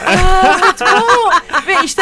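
high, loud burst of laughing delight; real laughing cheer, high and loud. Real, not acted. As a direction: crack up at the surprise, way up high. Host erupts at the unprecedented offer.

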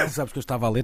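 coughing while speaking; coughing fit. Real, not acted. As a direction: talk through a cough. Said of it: "Até dá tosse".

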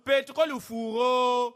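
slow lament. Acted.

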